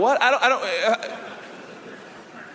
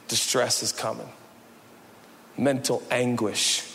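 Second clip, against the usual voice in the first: ordinary talk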